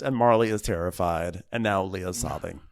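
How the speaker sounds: normal talking voice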